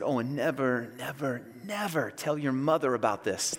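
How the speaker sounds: father quietly swearing his son to secrecy; hushed plea. Retold or imitated. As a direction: quietly beg him to keep a secret.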